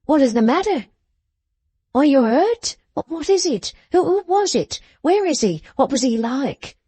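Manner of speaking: high-pitched anxious rescuer; alarmed questioning. A narrator's reading. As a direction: fire off worried questions at her. Rescuer anxiously questions frightened girl.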